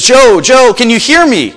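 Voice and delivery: voice from above. Retold or imitated. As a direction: call down to him like an unseen voice. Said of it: "And the voice says".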